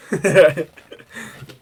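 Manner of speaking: goofy deer noise; deer grunts. Acted. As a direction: make a silly deer call. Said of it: "You guys like my deer impression?".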